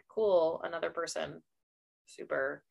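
mock-cheerful, quietly unimpressed; sarcastic. Listener unimpressed by a returning character, dry sarcasm.

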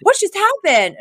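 lightly voiced confused friend; mock bewildered Val. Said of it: "Val's like".

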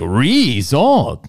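"Resort" with a heavy stressed ree; mock dad saying resort; dad's resort. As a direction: mimic dad leaning on the re. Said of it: "He's like".

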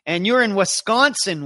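Wisconsin in an upper Midwest accent. Shown as a demonstration. Host greeting a viewer in Wisconsin, upper Midwest accent. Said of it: "I can do that in upper Midwest accent".

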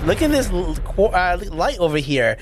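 high, eager call to look; eager pointing out. Retold or imitated. Mentor showing the way, high and eager. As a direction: point it out like a guide. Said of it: "I was like".

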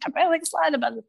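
fast, high-pitched gushing; hammed-up, breakneck excitement, pitched well up; excited. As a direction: gush it out in a rush to everybody. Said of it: "but I will tell everybody".

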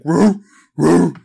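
loud, high woofing dog; dog barking. Retold or imitated. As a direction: bark it out like a big dog.

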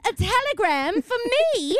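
very high, slow hammed-up delivery; mock-grand period-drama voice; theatrical old-timey lady. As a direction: play an old-fashioned belle receiving news. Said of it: "Why are you talking like that?".

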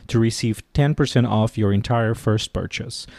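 ordinary talk, nothing marked

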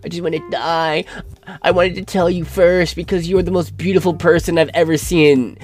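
mocking whiny voice. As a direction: make the words sound pathetic. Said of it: "quoting the message mockingly".

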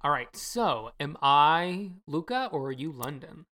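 ordinary talk

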